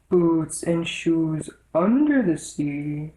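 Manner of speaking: slow, low creature voice; deep Gryphon voice. A narrator's reading. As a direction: speak slowly and deep like the Gryphon. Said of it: "the Gryphon went on in a deep voice".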